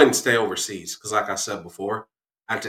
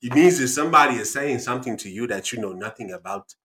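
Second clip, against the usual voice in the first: put-on emphatic African-accented explanation. Dad explains his quote to daughter, African accent. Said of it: "African accent".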